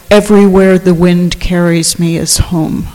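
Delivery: normal talking voice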